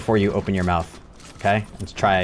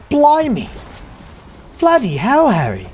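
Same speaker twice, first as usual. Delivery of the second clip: high, loud mock British exclamation; a cartoonish British accent, hammed up; 12 semitones higher, 4 dB louder than usual. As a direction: shout it like a cartoon British kid. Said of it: "I'm not out here saying shit like".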